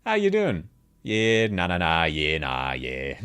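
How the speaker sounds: broad Australian caricature, falling low by the end; exaggerated ocker "yeah nah" sliding down; thick Aussie accent. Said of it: "can we get a thick Aussie accent?".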